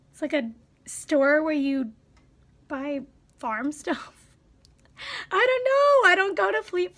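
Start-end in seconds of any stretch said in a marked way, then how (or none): 5.3-7.0 high, loud "I don't know"; exasperated. Real, not acted. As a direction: throw up your hands vocally.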